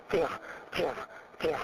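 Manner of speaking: short, separate 加, each forced out with voice; forceful voiced 加. Shown as a demonstration. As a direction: say 加 pushing the voice out with effort. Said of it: "然后用力出声音".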